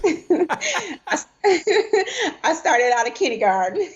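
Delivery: high laughs breaking into the words; laughing at her own joke. Real, not acted. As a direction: giggle through the joke about starting in kindergarten. Jokes about her own age.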